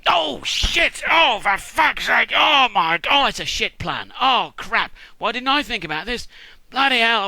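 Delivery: loud, high comic meltdown; hammed-up panic; panicked cursing. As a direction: wail and swear as the scheme falls apart. Acted mother panics over failed plan.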